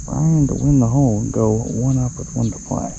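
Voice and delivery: measured TV golf call; golf commentator. Pretend. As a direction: set up the putt like a broadcaster. Golf play-by-play on shot.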